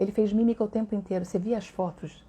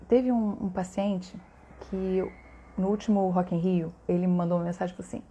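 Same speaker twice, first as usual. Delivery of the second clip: ordinary talk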